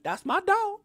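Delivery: high squeaky imitation of Smoke; Smoke quote. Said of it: "And, and Smoke goes".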